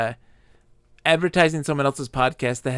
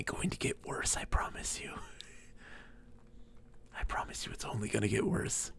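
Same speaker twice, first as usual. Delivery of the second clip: whisper; 6 semitones lower, 14 dB quieter than usual. Real, not acted. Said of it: "teasing promise to listeners".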